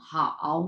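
হাও drawn out, low and dipping; third-tone হাও. Shown as a demonstration. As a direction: demonstrate হাও as Mandarin tone three. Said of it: "তিন number tone".

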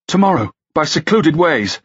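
high, hoarse-voiced suggestion; quick and hoarse. A narrator's reading. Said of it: "quick and hoarse".

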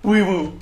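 very high, loud wee woo siren; loud gleeful siren; siren imitation. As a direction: blare it like an ambulance. Siren noise after win.

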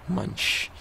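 high voiceless munching noise; playful chomp sound; mock munch. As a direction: imitate a chewing sound. Imitating eating noise.

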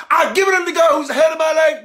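high, loud ritual declaration; mock the stock testimony opener; rote church testimony. Churchgoer recites the taught line, high and loud. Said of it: "Was you taught to say".

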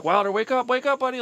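high, desperate pleading shout; frantic yelling. Retold or imitated. Father yelling to wake his unconscious son, frantic high pleading. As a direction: yell to wake a limp child. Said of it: "yelling, like".

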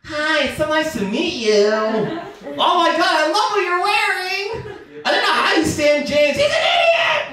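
very high, cute, sugary greeting voice; really high and cute. Retold or imitated. Mom and girlfriend meeting, suddenly very high cute voices. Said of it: "they [name]'d their voices".

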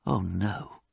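soft, hushed dismay; quiet. A narrator's reading. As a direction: say it quietly, taken aback. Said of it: "said Basil quietly".

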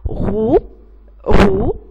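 loud, isolated "غو", ghayn with u; letter sound demo. Shown as a demonstration. Teacher prompting pupils for another word, modelling the sound "غو". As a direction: punch out "غو" twice with a gap. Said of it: "اللي هي صوتها".

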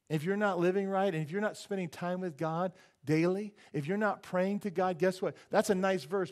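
loud, high preaching, building the point; rising pulpit proclamation; full-force preaching. Acted. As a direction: preach it out to the whole room.

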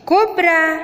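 કોબ્રા pitched high, for copying; teacher's high, careful કોબ્રા; English pronunciation demo. Shown as a demonstration. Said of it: "અંગ્રેજી ઉચ્ચાર થશે".

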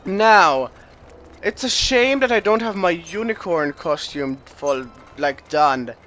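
usual speaking voice

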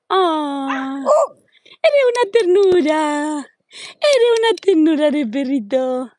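high, slow, choked-up cooing. Real, not acted. Gushing over a sweet puppy, high and teary baby talk. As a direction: melt over the puppy until your voice catches.

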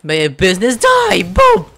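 loud jeering shout, ending in a yelled boom; mock yell at the shopkeeper; mock shouted curse. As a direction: bellow it as a rude joke blessing. Player mocks NPC's blessing, shouting.

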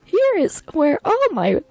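slow, high, theatrical welcome; hammed-up, drawn-out high voice; grand character greeting. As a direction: welcome a young friend in a lofty put-on voice. Plays a greeting character, cracks up.

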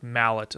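"mallet" with a hard final T; "mallet" stressed up front, T sounded; English pronunciation. Retold or imitated. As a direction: say "mallet" the English way, rhyming with ballet-free "pallet". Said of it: "they pronounce it, like, if it's".

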